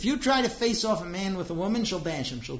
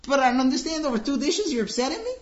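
higher and louder, rising in disbelief; can't believe she's upset, push back; exasperated husband; 9 semitones higher, 5 dB louder, faster than usual. Acted. Husband to wife over two dishes, exasperated. Husband answering wife's complaint.

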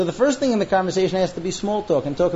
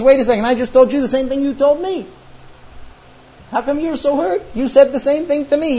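indignant protest; 7 semitones higher than usual. Acted. Acted husband protesting in argument.

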